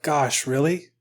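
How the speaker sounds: softly spoken, rising question; hushed acted surprise, still voiced; quiet disbelief. The Pope hearing God's threat, soft disbelief. The Pope's reply, then echoed.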